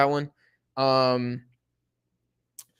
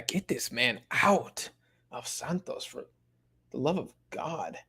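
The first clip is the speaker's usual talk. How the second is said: exasperated whisper; 7 dB quieter, slower than usual. Real, not acted. Exasperated plea about player.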